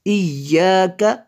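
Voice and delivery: इय्याका chanted with the Y leaned on; Tajweed इय्याका with a firm geminated Y; pressed double Y. Shown as a demonstration. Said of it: "इसको थोड़ा दबा के पढ़ेंगे".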